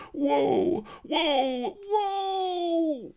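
very high, long wail of a frightened rabbit. A narrator's reading. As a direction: cry out in dread as he runs down. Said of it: "wailed the Key Rabbit".

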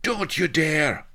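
high, loud, drawn-out protest; bawling. A narrator's reading. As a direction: bawl it in outraged alarm. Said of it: "bawled Blood".

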